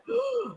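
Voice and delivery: high, sharp gasp of shock; startled gasp. Retold or imitated. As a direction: gasp as if suddenly spooked. Acts out her startled reaction.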